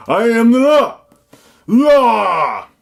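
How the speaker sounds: high, bellowed catchphrase; original movie hero. Acted. Reviewer mimicking the original film, over-the-top high shout. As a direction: yell it out with the last word drawn long. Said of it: "That's the original one".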